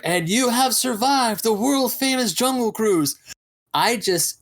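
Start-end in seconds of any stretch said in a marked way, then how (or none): 0.0-3.2 higher, slow announcer delivery; skipper's spiel. Retold or imitated. Skipper welcomes riders back to the dock, imitated spiel. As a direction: announce it grandly to the whole boat. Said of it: "where the skipper is like".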